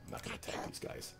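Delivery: soft unvoiced whisper; hushed aside. Real, not acted. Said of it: "whispered aside".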